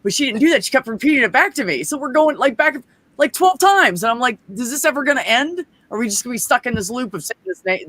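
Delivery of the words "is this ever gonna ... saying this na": high-pitched, fed-up question; exasperated retelling. Retold or imitated. Learner stuck repeating a word back and forth, exasperated. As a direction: act out being stuck in a loop.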